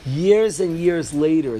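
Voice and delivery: ordinary talk, nothing marked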